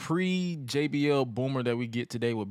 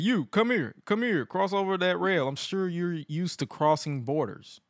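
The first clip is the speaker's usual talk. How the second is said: higher, bossy summons, lightly put on; JBL voice; 5 semitones higher than usual. Host retelling JBL calling fan over, mock bossy. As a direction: beckon the fan like a smug boss. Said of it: "he told him, said".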